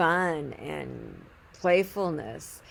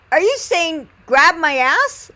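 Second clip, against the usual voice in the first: high, loud, stretched-out disbelief; drawn-out, raised-voice astonishment; incredulous question; 9 semitones higher, 9 dB louder, slower than usual. Retold or imitated. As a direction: check loudly, scandalized by what you heard. Incredulous check of what was heard.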